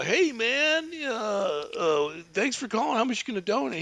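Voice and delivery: lightly voiced stoner on the phone; stoner guy. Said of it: "he gets the stoner guy".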